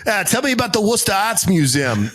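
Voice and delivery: hammed-up Worcester local; Boston accent. Showing off Worcester knowledge, Boston accent. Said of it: "pronounce Worcester correctly".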